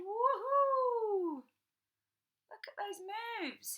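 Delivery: high, loud whooping woohoo; excited cheer. Pretend. Reading to kids, cheering the dancing boy in the picture with a loud woohoo. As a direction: cheer him on like a fan at a show. Cheering David's dance moves.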